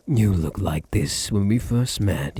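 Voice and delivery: usual speaking voice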